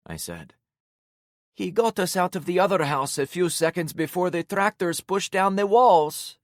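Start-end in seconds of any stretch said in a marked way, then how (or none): 1.6-6.4 put-on high, squeaky tearful girl voice. A narrator's reading. Em telling the narrator how he saved them, squeaky. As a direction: squeak it out through tears. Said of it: "Em squeaked".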